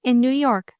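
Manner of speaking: ordinary delivery, nothing marked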